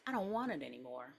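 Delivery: low, very soft refusal; quiet little-boy sulk; sulky child. Acted.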